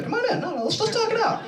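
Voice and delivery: high, coaxing mock reporter voice; Chris Hansen. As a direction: imitate a host beckoning in a high singsong. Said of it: "this reporter named Chris Hansen".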